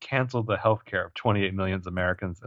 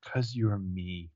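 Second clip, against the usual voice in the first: slow quiet echo; 9 dB quieter, much slower than usual. Retold or imitated. Quoting the father's line.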